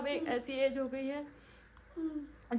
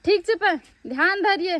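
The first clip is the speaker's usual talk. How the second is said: loud, higher-pitched send-off warning; calling out caution; 5 semitones higher, 13 dB louder than usual. Real, not acted. Woman warns departing riders to take care, loud calling. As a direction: call out to be careful.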